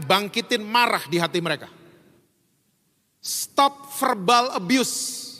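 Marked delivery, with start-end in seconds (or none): 3.2-5.3 each word spaced out, slow and deliberate; slow emphatic. Real, not acted. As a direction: lay down the rule one word at a time. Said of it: "emphatic slow command".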